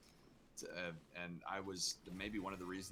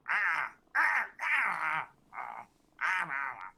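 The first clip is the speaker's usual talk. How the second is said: loud, higher creature yells in bursts; grumpy teenage imp cries; 9 semitones higher, 9 dB louder, slower than usual. Shown as a demonstration. Voice actor demonstrating the Apex Imp, grumpy loud cries. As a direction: yell like a sulky young demon. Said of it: "him being a grumpy teenage, like, imp".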